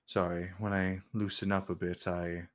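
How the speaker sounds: low, soft aside to his knight; understated, haughty king with pauses; lightly voiced king, offhand. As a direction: confide quietly as a relaxed ruler. Game master voices the king.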